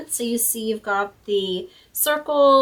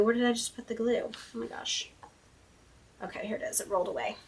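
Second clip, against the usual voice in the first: whispered to herself; 9 dB quieter, faster than usual. Real, not acted. Searching for lost glue.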